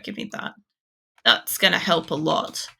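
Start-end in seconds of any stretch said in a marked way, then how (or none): 0.0-0.5 under-the-breath whisper to himself; whispered aside. Real, not acted. Streamer whispering to himself over loot. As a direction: breathe it out while looting.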